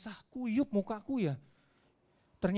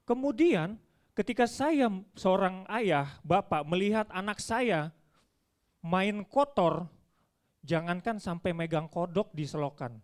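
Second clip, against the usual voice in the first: ordinary talk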